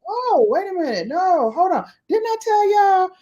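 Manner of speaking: high, sassy retort; sassy comeback. Retold or imitated. Her sassy retort to family.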